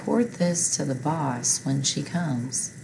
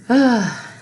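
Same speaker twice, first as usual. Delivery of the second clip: a big, high, loud sigh; loud demo sigh; 8 semitones higher, 9 dB louder than usual. Shown as a demonstration. Teacher acting out a big sigh for the class. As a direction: heave a big sigh to show it. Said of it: "I took a big sigh".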